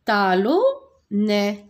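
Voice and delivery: talonnet very slow, each syllable apart; slow model word. Shown as a demonstration. Teacher telling pupils to watch, then saying talonnet slowly. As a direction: stretch out talonnet so the class hears it. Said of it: "Regardez bien".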